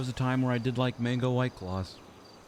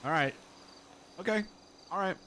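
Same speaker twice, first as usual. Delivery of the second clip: normal talking voice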